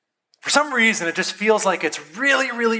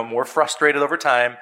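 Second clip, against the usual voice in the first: ordinary delivery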